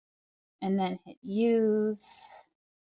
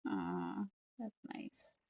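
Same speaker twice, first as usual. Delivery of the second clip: soft aw; 13 dB quieter, much slower than usual. Real, not acted. Hearing the device say I love mama.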